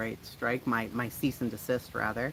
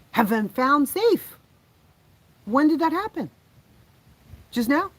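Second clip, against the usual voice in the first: high, loud, delighted surprise; excited surprise; 8 dB louder than usual. Real, not acted. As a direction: burst out in relief at the news. News children found safe.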